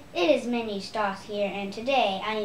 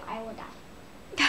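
whispered; 5 dB quieter, much slower than usual. Real, not acted. Quiet aside.

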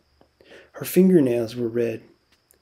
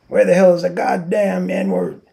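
loud, higher angry holler; angry shout; 6 semitones higher, 8 dB louder than usual. A narrator's reading. A man outside bellowing for the stable buck. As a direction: yell it out impatiently.

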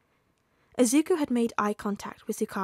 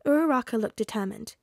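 plain reading voice

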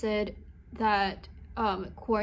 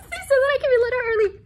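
excited rush; 13 semitones higher, 10 dB louder, much faster than usual. Real, not acted. Blurting the good news.

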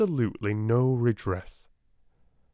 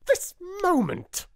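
much higher and louder, a fierce slow command; 4 dB louder than usual. Acted. Headmistress orders her sister, fierce and high. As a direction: clip it out, no argument. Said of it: "was the fierce answer".

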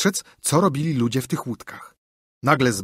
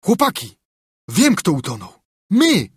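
high, loud triumphant cry; excited shout; 6 semitones higher, 5 dB louder than usual. A narrator's reading. Tom telling his friends they are the drowned, shouting. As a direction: announce it like a great discovery. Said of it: "Zawołał".

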